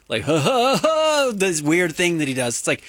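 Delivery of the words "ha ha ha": high, rising staccato fake laugh; Kawhi Leonard laugh. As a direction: imitate a weird clipped laugh. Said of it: "does the funny laugh".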